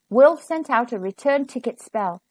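plain reading voice

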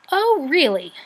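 high, loud, falling burst of offence; affronted storybook rat; indignant gasp. A narrator's reading. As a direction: take great offence at the insult. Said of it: "Rat gasped indignantly".

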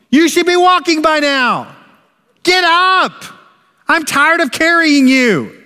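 a mock-angry parent shouting at a toddler who won't walk; impatient harsh yelling. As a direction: berate the baby for not walking, hammed up. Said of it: "we wouldn't use that same voice".

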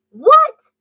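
Mama Cat shocked by the lost mittens; shocked what. A narrator's reading. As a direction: throw the word up in alarm. Mother hears mittens lost.

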